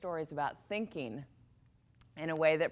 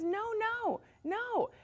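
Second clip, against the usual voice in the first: much higher, building to a loud cry at the end; excited friend; 7 dB louder than usual. Acted. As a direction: insist happily that it was for the best. Friend reassuring the king.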